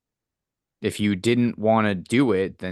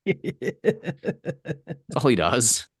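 higher, slow, laughing through the words; 6 semitones higher, much slower than usual. Real, not acted. Co-host joking about the intern's vacuuming job, laughing. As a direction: crack up while saying it.

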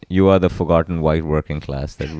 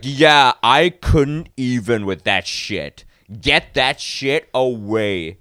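slow drawl; 6 semitones higher, much slower than usual. Retold or imitated. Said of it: "And he's like".

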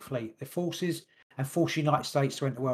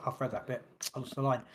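ordinary talk, nothing marked

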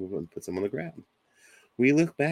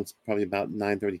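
normal talking voice

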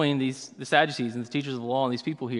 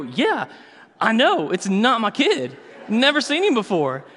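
higher and louder, laughing through it; laughing disbelief; 7 semitones higher, 5 dB louder than usual. Retold or imitated. Dad telling the neighbor it isn't his kid, laughing. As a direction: crack up as you admit it.

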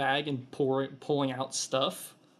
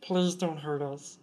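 pleading kobold; 3 semitones higher than usual. Acted. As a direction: offer everything, cowering and sorry. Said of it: "he goes".

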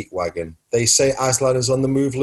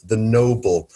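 ordinary delivery

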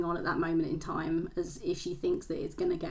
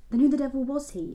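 higher and louder, can't believe it; incredulous; 7 semitones higher, 7 dB louder than usual. A narrator's reading. Reader voicing Liversidge cutting in, incredulous outburst. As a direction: burst out in disbelief. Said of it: "interrupted Mister Liversidge incredulously".

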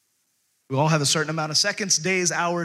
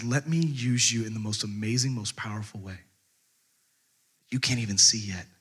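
low, hushed, sinking softer; tender promise; 5 semitones lower, 4 dB quieter than usual. Acted. God speaking to Esther about using her, soft and low. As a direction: let it settle into quiet assurance.

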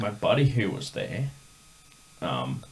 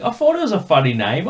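high, louder Cockney impression; squeaky amused Cockney; mock Cockney; 8 semitones higher, 8 dB louder than usual. Imitating the singer amused by the name.

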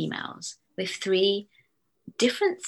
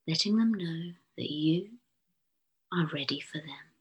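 guided meditation. Acted. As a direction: lull the listener gently into calm. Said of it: "using my posh hypnotherapist's voice".

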